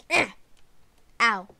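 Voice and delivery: normal talking voice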